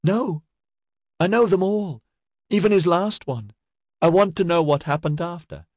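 high-pitched woman's insistence; insistent protest. A narrator's reading. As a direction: push back urgently, pitched high. Woman insists in narrated quarrel.

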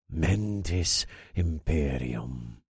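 soft, slow muttered incantation. A narrator's reading. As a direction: mutter the spell under your breath. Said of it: "I muttered".

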